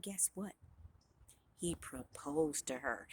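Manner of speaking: very soft, low, slowing almost to a whisper; hushed reveal. A narrator's reading. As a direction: let them in on the secret. Narrator builds to the big reveal.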